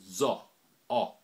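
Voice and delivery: "zo" with a wide open o; Patois "zo". Shown as a demonstration. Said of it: "have an O which is opened".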